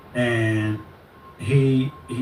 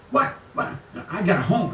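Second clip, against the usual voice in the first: higher-pitched, startled mock retort; mimicking his outburst; 7 semitones higher than usual. Imitating the man answering about his address, high mock outburst. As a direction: imitate him cutting in, pitch up. Acted quote of the ranting man.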